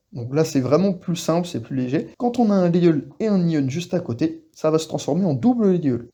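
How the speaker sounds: ordinary delivery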